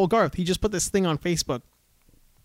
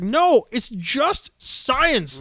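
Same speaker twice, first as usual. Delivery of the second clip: higher, slow condescending drawl; mocking the Walmart freakout guy, condescending; mock smug ranter; 6 semitones higher, much slower than usual. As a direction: stretch it out like a smug know-it-all.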